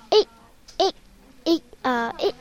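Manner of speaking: sharp, separated marching count; drill command. A narrator's reading. Sheep commander calls the count to armed soldiers, drill command. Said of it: "大声喊口令".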